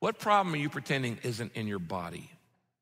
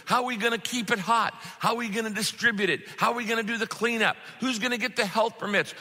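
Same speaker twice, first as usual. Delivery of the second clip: imitating a frantic Peter, pitched up; fretting Peter; 7 semitones higher than usual. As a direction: panic over the catering costs. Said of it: "I can just imagine Peter".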